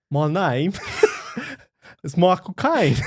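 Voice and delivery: hammed-up celebrity impression through giggles; Michael Caine. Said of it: "can you do your impression of Michael Caine".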